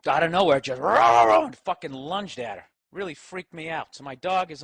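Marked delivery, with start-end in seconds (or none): 0.8-1.5 barked growl noises, louder; dog growl. Retold or imitated. Dog suddenly lunging at the friend, loud growl. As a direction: imitate the dog snapping.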